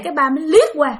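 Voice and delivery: ordinary delivery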